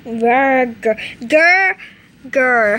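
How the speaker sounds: loud mock growls; loud, playful wolf growls; growling. Growling at her.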